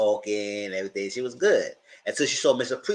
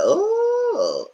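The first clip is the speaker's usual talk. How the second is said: mock high oh; 4 dB louder than usual. Mock reaction to friend's remark.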